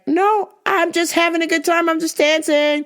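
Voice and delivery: imitated girl's polite refusal; woman declining. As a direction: politely brush him off in a high voice. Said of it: "She's like".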